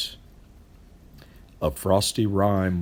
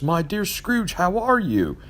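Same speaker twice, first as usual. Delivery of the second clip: a bright, higher friendly hail; cheery, higher, welcoming call; gladsome greeting; 11 semitones higher than usual. Acted. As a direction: greet him like a dear old friend in the street. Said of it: "to say with gladsome looks".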